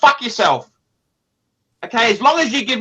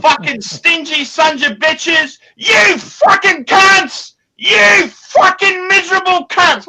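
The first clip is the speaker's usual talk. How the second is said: furious shout; 9 semitones higher, 7 dB louder, slower than usual. Acted. Ranting at the watch brand.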